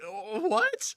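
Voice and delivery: high incredulous yelp; mock shock. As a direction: squeal it in disbelief. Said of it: "I'm like".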